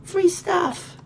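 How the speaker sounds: high, loud delighted yeah; gleeful passerby. Pretend. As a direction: crow over free stuff. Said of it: "Walking along going".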